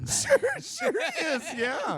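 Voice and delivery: high, loud, laughing "sure he is"; mock placating. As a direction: humor them with a laugh. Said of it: "definition of placation right there".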